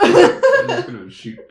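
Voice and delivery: high, loud, slow words through laughter; laughing hard. Real, not acted. Teller breaks up laughing mid-sentence, high and loud.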